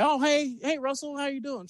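imitated high, friendly hello; Steve Bono. Backup quarterback greets the hotel manager, high and friendly. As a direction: play a backup quarterback greeting someone in a lifted voice.

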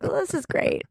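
deadpan fake cheer; sarcastic. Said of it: "I was like".

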